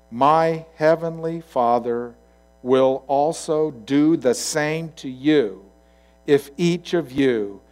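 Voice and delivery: very slow, weighted delivery; solemn warning. Acted. Jesus's words to inscribe on your heart, slow and solemn. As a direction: spell out the warning phrase by phrase.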